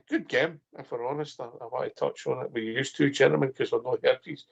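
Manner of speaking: ordinary talk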